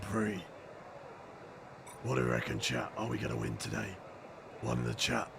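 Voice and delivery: low, soft husky broken voice; rough lost voice. Real, not acted. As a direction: talk through a voice that has gone. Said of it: "if I sounded like that normally".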